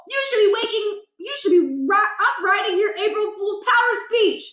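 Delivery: put-on high, loud old-man voice, half laughing at the fool; mock the sleeping son; cranky old father lecturing. Scene: father scolds son for sleeping in, cranky lecture.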